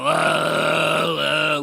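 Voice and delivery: thick phlegmy groan; phlegmy growl. Shown as a demonstration. Host demonstrating the phlegmy villain voice to viewers. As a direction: clear nothing, gurgle it out. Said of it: "just put extra phlegm in your voice".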